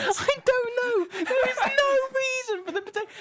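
real exasperation boiling over, very high; exasperated outburst. Real, not acted. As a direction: shriek it in helpless exasperation. Exasperated rant about French potato.

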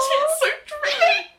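high fangirl squeal; swooning. Acted. Fan reacting to a romantic line, swooning squeal. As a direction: squeal it, melting with delight.

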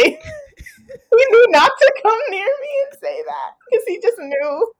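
high, breaking laugh through the words; can barely get it out for laughing; laughing while telling. Real, not acted. Guest recalling exam tears, laughing hard.

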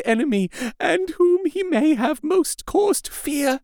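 very high, choked lament; whispering amidst sobs. A narrator's reading. Wife seeing blood on her husband's robe, choking sobs. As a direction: wail it in anguish, as through sobs. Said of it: "whispered, amidst choking sobs".

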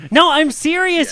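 high, loud plea to be believed; urgent insistence. Acted. Lookout to disbelieving crew, urgent shouting. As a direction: shout it in earnest alarm.

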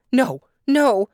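high, loud protest; alarmed storybook objection; crying out. A narrator's reading. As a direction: cry out to stop them. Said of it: "cried Tante Adela".

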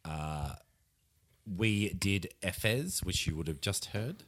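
hushed and close, like an ASMR video. Acted. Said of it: "stop the, uh, A S M R voice now".

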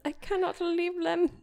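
put-on lightly French-accented plea; a hint of a French accent. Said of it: "But in a French accent".

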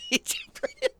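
squeaky laugh into the words. Real, not acted. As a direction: laugh in delighted disbelief. Laughs at the hero's leap.

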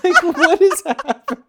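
laughing. Real, not acted. Laughing while listing friend's antics.

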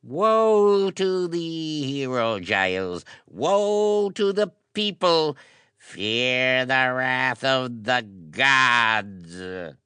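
high, slow prophet of doom; solemn warning. A narrator's reading. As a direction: wail a solemn warning from the altar. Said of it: "in solemn warning".

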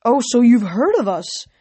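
flattered, with a lift in the voice; pleased. A narrator's reading. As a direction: ask with mild self-satisfaction.

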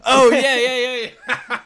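loud, high burst of realisation; laughing, high-pitched excited yeses; sudden recognition. Real, not acted. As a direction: blurt it out, delighted to remember. Suddenly remembers the booked musician.

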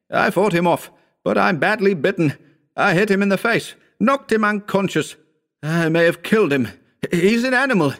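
high-pitched, anguished outpouring; moaning. A narrator's reading. Bitten Howard telling his friend of the attack, moaning. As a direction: gasp out the account in high, strained distress. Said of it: "he moaned".

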